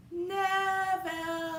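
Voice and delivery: high, long-drawn call; loud sing-out holler. A narrator's reading. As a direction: stretch the name out to carry down the block. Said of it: "and called out".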